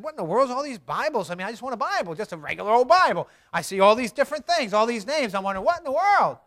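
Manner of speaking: hammed-up loud puzzlement; mock bewilderment. Re-enacting bewilderment at all the Bibles.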